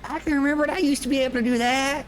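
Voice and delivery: put-on high, fast wistful old-timer; old man voice. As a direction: reminisce like a crusty grandpa. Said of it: "and I go".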